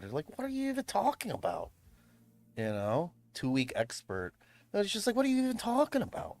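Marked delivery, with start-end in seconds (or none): 0.4-1.7 higher, incredulous mock voice; mock exasperation. Streamer mocking know-it-alls with a higher incredulous voice. 5.2-6.4 higher, fast incredulous mock voice; fast mock exasperation. Streamer repeating the mockery with a higher, faster incredulous voice. Mocking the other side again.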